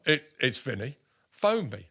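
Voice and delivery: hammed-up footballer barking a command; Vinnie Jones voice. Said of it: "I imagine this is what he said".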